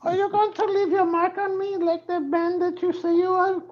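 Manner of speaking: mock housekeeper voice. Comic teasing the rock star as a falsetto housekeeper. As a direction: ham up a high-pitched hotel maid. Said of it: "I'll be like this".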